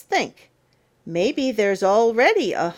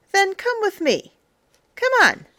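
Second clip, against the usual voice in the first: coaxing; 11 semitones higher, faster than usual. A narrator's reading. Luring the reader along.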